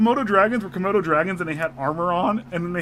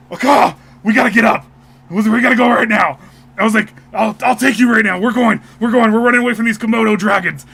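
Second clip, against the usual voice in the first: louder, urgent retold call to flee; mock urgent shout; 4 semitones higher, 8 dB louder than usual. Husband retelling dream, urging wife to flee. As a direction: hurry her out, half-acted. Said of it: "and I was like".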